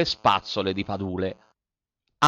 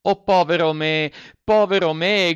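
high, loud despairing wail; cry out; 8 semitones higher, 6 dB louder than usual. A narrator's reading. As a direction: wail over his own bad luck. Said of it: "gridò Pinocchio". (grido disperato, acuto e forte)

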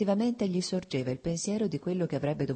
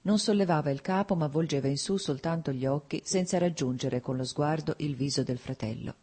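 plain reading voice

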